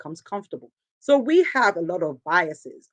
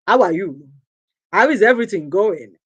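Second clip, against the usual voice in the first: put-on loud caricatured African accent; mock African accent; 6 dB louder than usual. People trying to mimic Africa, in a put-on African accent. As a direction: imitate an outsider's overdone African accent. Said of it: "trying to mimic Africa".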